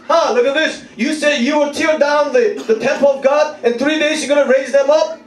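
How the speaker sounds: high, insistent jeering; mocking taunt. Crowd insulting Jesus on the cross, mocking. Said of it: "people are mocking Jesus".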